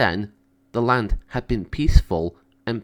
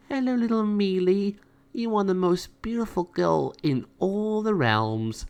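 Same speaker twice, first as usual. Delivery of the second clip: put-on tender greeting to a baby; 9 semitones higher than usual. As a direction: coo lovingly at the new kitten. Natasha greets newborn.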